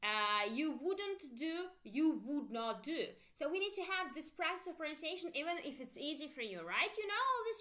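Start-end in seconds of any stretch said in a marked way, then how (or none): 0.0-3.1 slow "you wouldn't do" then "you would not do"; slow drill. Shown as a demonstration. Teacher slowly modelling for pronunciation practice. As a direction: give each form time to be copied. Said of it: "Make sure you pronounce with me".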